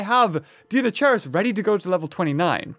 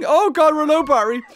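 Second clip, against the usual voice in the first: surprised; 9 semitones higher, 5 dB louder than usual. Real, not acted. Battery warning pops up.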